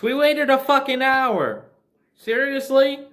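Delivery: high, loud, exasperated disbelief; exasperated outburst. Real, not acted. Griping to viewers about the doctor's delay, loud exasperated outburst. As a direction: throw up your hands at the wasted hour. Ranting about hour-long wait.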